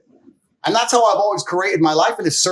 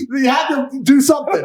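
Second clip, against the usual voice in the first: laughing; 5 semitones higher, 5 dB louder than usual. Real, not acted. Laughing loud while telling.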